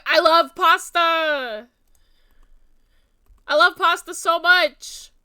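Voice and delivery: loud, drawn-out, hammed-up Jersey accent; New Jersey accent. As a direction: belt it out slowly in broad Jersey. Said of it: "can people tell that I'm from New Jersey".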